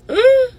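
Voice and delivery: startled moan. Retold or imitated. Shocked at news.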